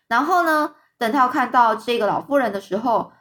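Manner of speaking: plain reading voice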